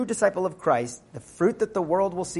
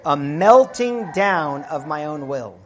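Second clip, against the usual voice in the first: slow emphasis; 4 dB louder, slower than usual. Acted.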